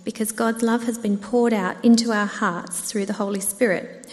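ordinary talk

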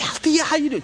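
high-pitched happy hello; delighted greeting. Retold or imitated. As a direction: act out greeting a long-lost friend. Greets long-unseen friend in story.

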